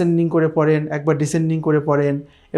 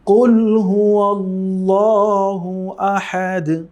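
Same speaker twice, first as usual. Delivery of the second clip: কুল হুয়া আল্লাহু আহাদ drawn out and chanted; slow recitation; much slower than usual. Shown as a demonstration. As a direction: recite slowly in tilawat melody. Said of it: "আমরা আবার পড়ছি". (ধীর সুরেলা তিলাওয়াত)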